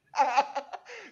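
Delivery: loud, high burst of laughter; big high-pitched laugh. Real, not acted. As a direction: burst out laughing at your own story. Laughs at his own anecdote.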